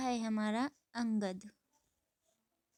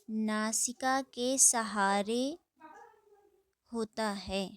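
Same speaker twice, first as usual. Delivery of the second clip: slow dictation. Shown as a demonstration. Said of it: "dictating definition".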